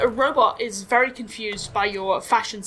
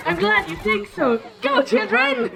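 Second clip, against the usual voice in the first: a higher, proud robot voice; 4 semitones higher than usual. Acted. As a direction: announce it like a proud parent. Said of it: "He says".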